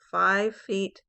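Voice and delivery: ordinary talk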